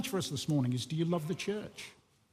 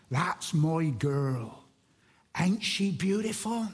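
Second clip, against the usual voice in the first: put-on Wiltshire accent; slower than usual. Tearful old husband admiring his wife, slow Wiltshire accent. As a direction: imitate an old Wiltshire man, slow and doting. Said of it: "in his Wiltshire accent".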